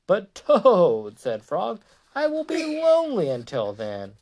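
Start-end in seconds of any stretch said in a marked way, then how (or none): 0.1-1.2 high, loud drawn-out protest; pleading. A narrator's reading. Frog pleads with sleepy Toad. 2.1-4.2 high, held-out storybook plea; plaintive. A narrator's reading. Frog pleads with sleepy Toad.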